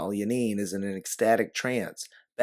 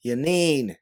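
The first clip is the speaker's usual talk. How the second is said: her name called louder and higher; yell; 6 semitones higher, 6 dB louder than usual. A narrator's reading. You yell at Yanine to refocus her, loud call. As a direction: snap her name out to pull her back. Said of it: "you yell as you refocus".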